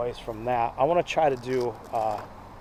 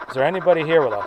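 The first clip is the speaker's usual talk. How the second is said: calling out; 3 semitones higher, 7 dB louder than usual. Real, not acted. Calling out to spirits.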